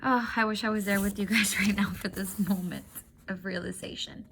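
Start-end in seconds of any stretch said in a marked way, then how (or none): none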